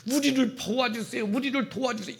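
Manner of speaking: high, acted plea for help; tearful prayer. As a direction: beg God as if through tears. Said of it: "눈물로". (눈물로 호소하는 기도 흉내)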